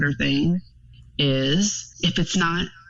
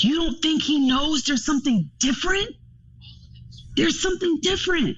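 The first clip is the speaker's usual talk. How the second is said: emphatic insistence; 10 semitones higher than usual. Retold or imitated. Quoting herself to dad.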